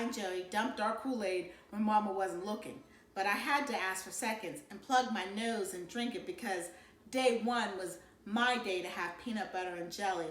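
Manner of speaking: ordinary delivery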